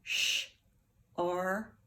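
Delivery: Sh, R, K tapped one sound at a time. Shown as a demonstration. Teacher sounding out the word for the class, sound by sound. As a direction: tap out "Sh-R-K" sound by sound. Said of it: "Let's tap it".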